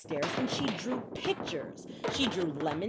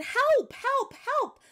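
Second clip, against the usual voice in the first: high, loud frantic calls; panicked cry for help; 5 dB louder, much slower than usual. Acted. Mother phoning the doctor in a panic, high frantic cries. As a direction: yell for help down the phone.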